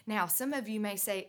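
usual speaking voice

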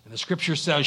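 usual speaking voice

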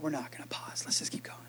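unvoiced whisper to the room. Real, not acted. Preacher whispering to the congregation to keep going. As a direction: hush it, let them lean in.

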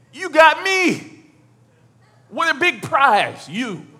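high, loud, drawn-out mock brag; hammed-up boasting. Husband offering himself as consolation, hammed-up boast. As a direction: crow it slowly like a grand prize. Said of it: "He says, and this is the icing on the cake".

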